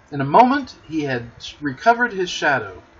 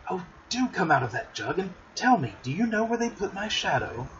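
put-on a high, eager boy's voice, rising higher; Peter pleading; 5 semitones higher, 3 dB quieter than usual. A narrator's reading. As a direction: coax her out of the jug like an impatient boy. Peter pleading with Tinker Bell.

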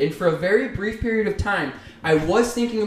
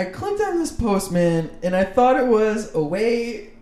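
high, giggly telling; fighting laughter through the story; laughing while telling; 8 semitones higher than usual. Real, not acted. Laughing while telling dishwasher post.

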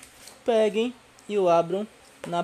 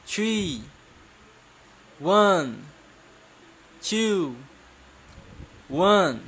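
teacher drill calling; 3 semitones higher, 3 dB louder, much slower than usual. Shown as a demonstration. English lesson number drill game.

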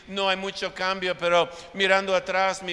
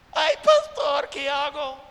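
much higher, wailing mock cry; tearful plea; 7 semitones higher, 4 dB louder than usual. Pastor imitating a crying congregant pleading for help, high wail. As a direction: whimper it like a congregant about to cry. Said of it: "me dicen llorando".

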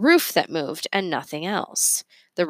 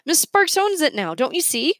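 distressed; 10 semitones higher, 3 dB louder than usual. A narrator's reading. Boy upset, close to tears.

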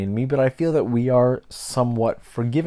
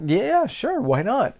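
high, bright-pitched yes; eager agreement; 12 semitones higher, slower than usual. Retold or imitated. Learner agreeing to clerk's correction, high and eager. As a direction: toss it off in a much higher voice. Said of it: "I'm like".

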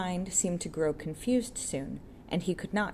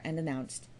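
usual speaking voice

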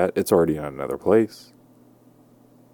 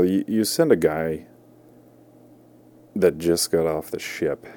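usual speaking voice

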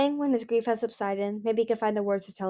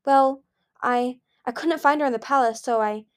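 put-on frightened child; 5 semitones higher, 5 dB louder than usual. Scared child excuses to father.

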